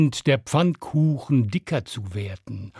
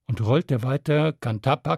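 plain reading voice

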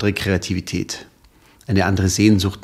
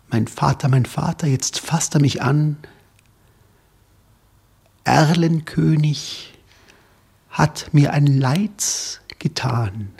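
higher, slow, breaking into whisper; terrified child; 4 semitones higher than usual. Acted. Child cries he has been hurt, higher whisper. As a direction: gasp out the final cry.

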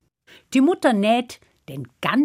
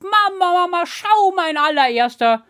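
excited calling; 10 semitones higher than usual. Acted. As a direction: call proudly across the yard. Hans runs calling his mother.

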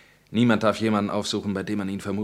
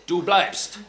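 raised, commanding, the word bleibst punched; firm order; 6 semitones higher, 4 dB louder than usual. Acted. Captor telling the prisoner he stays, firm and raised. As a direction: set the limit in a firm, raised voice.

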